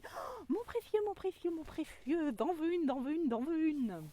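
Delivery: hiss-whine it like a covetous little creature; greedy inner ego. Pretend. Greedy ego eyeing glowing stones, in a high creature whine. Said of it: "mon petit égo intérieur dit".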